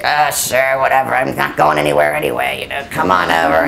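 put-on low, offhand old man's voice, shrugging it off; gruff old man. The cranky stuntman inviting the reporter over, low and dismissive. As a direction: drop low and brush it off like a worn-out old stuntman. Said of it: "he was like".

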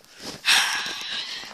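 loud, airy sigh; heavy exhale after a fumble. Real, not acted. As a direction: blow out a big breath. Sighs after camera fumble.

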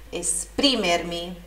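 "Esprimermi" with a strong es-PRI-mer-mi; stress on PRI. Shown as a demonstration. Teacher naming the tonic syllable, stressed PRI in "Esprimermi". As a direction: lean hard on PRI in "Esprimermi". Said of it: "sílaba tônica no pri".

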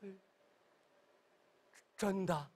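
ordinary delivery, nothing marked

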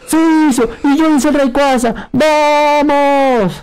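excited cheer. Real, not acted. Win secured in match.

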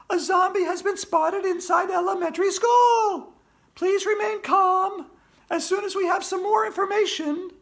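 very high, loud bulletin voice; Andrea, urgent newsreader. Acted. Andrea reporting a zombie on air, shouty announcer.

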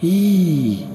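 long, high cry falling away; shriek. A narrator's reading. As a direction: let out a piercing wail. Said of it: "un hurlement de frayeur".

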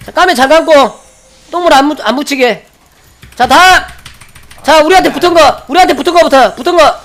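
shouting raid commands, high and loud; yelling across the room. Real, not acted. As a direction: bark orders over the battle. Raid leader calling out commands.

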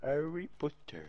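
"Harry Potter" slow and soft, posh English vowels, drawn-out "Harry"; breathy, haughty British "Harry Potter"; snob English accent. Pretend. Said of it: "Dites Harry Potter avec un accent anglais snob". (accent anglais snob, lent et feutré)

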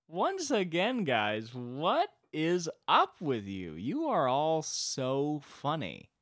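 high, slow, loud mock scolding; exasperated God. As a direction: draw out each word in disbelief. God exasperated by human inventions.

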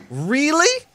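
high rising disbelief; a squeaky stunned really; incredulous. Real, not acted. Disbelief at being killed.

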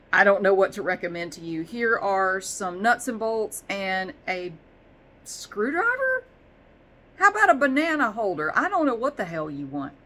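puzzled salesman throwing out guesses; voicing Amazon. Amazon confused by the shopping, laughing exasperation. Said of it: "Amazon's just going".

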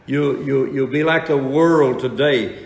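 loud, ringing sermon delivery; preaching at full force. Acted. Preacher to his congregation, preaching loud and full.

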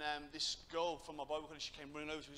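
normal talking voice

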